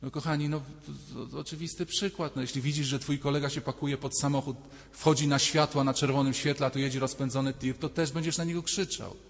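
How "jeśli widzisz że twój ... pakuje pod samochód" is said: ordinary talk, nothing marked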